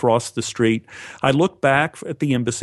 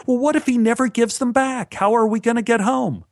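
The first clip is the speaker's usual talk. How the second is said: imitating distressed girlfriend; 12 semitones higher than usual. Girlfriend Anne panics at the embassy, high distressed voice. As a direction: play Anne frantic, voice climbing high. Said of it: "Anne came up to me in distress".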